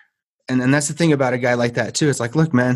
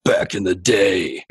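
Jocko impression; 2 semitones lower, slower than usual. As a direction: drag it out slow like Jocko. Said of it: "he says them in his way".

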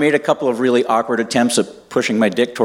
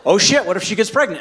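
retold panic; 10 semitones higher than usual. Retold panic, comic repetition.

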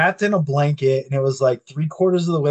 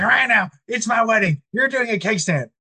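higher-pitched mock demand; retold mad bride; 7 semitones higher than usual. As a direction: imitate an insistent bride at moderate volume. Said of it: "Melissa was so fucking mad at me".

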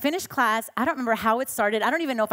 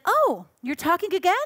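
mock teacher; 7 semitones higher, 3 dB louder than usual. Teacher catches her talking.

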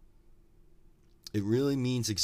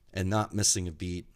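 ordinary talk, nothing marked

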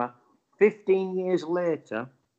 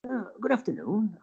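higher, polite well-spoken greeting; 7 semitones higher, 4 dB quieter than usual. Retold or imitated. Older angler greets a stranger, lightly voiced well-spoken. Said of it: "and very well spoken".

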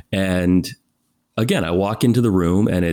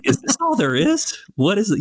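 incredulous; 13 semitones higher than usual. Retold or imitated. Baffled at images online.

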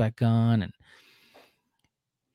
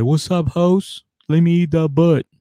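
player Marcelino; 7 semitones higher, 7 dB louder than usual. Retold or imitated. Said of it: "he's like".

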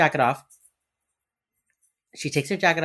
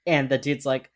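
normal talking voice

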